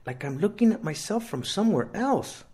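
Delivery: put-on a scared kid describing a strange feeling; Rooster, sick teen boy. A narrator's reading.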